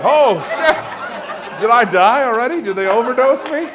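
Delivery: high, loud panicked patient; mock alarm. Patient startled by the doctor, hammed-up alarm. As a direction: blurt it out in comic shock.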